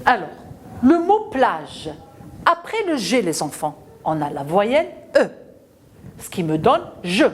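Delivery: usual speaking voice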